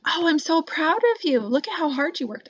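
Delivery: high, gushing praise, stress on so. Shown as a demonstration. Teacher showing the praise to avoid, high and gushing. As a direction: pour it on like a proud parent. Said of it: "So I'm not saying".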